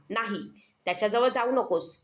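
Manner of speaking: ordinary delivery, nothing marked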